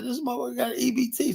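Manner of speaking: fast, high, hushed disbelief; incredulous. Retold or imitated. Retelling the checkout line, hushed high incredulity. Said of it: "I said".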